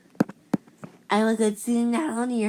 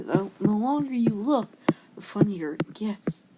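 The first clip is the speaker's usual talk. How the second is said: ordinary delivery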